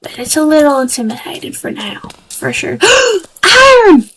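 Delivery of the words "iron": gasps then cries it out high and loud; thrilled at spotting the ore; excited gasp. Real, not acted. Gamer spotting iron ore, a gasping excited cry.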